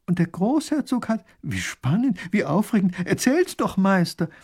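high, eager pleading; thrilled eagerness. Acted. Lady urging the painter to tell, thrilled. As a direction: beg for the story, pitch leaping.

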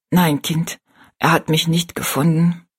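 ordinary delivery

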